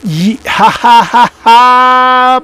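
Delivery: loud imitated belly laugh; mock guffaw. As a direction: fake a big yucking-it-up laugh. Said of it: "these guys are just yucking it up".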